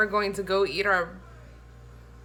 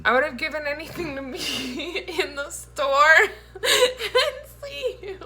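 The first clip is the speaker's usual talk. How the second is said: high, loud laughing defense; 9 semitones higher, 8 dB louder than usual. Real, not acted. Wife defending herself while laughing high and loud. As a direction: plead while cracking up.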